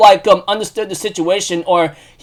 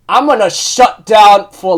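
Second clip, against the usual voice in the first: normal talking voice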